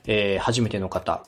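ordinary talk, nothing marked

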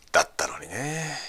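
breathy whispered regret. Real, not acted. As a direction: whisper it like a letdown. Sighing over wasted plans. (ささやき声で)

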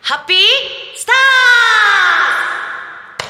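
loud, high cheering shout; big shout. Acted. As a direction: yell it out with full energy. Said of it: "大きい声で言いたいこと".

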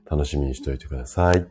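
ordinary talk, nothing marked

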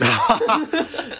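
loud high laugh falling away. Real, not acted. Partner laughing at the phone mom comparison, high laugh.

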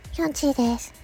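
normal talking voice

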